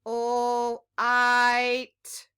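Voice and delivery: "Ul" held as a long l, then "it" said separately as long i plus t; sounding out. Shown as a demonstration. Teacher helping children decode a big word, sounding out. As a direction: stretch each sound of "light" apart. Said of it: "break it down into two parts".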